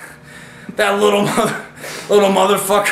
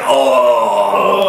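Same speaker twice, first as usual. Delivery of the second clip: pained oh; 9 semitones lower, 4 dB louder than usual. Retold or imitated. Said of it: "he was like".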